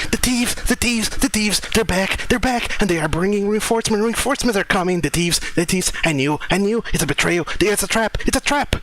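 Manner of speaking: high-pitched frantic owl squawking an alarm; rapid, panicked high screeching without much extra volume; screaming owl familiar. Acted. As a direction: shriek the warning like a terrified bird. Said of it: "Beaker just starts screaming".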